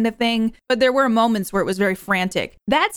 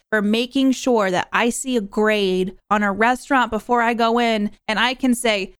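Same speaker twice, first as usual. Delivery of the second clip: normal talking voice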